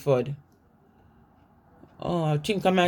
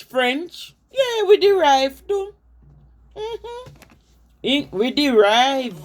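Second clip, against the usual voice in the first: much higher, lively patois retort in Miss Lou's voice; voicing Miss Lou; 6 semitones higher than usual. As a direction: fire back like a feisty Jamaican auntie defending her language. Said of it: "She said".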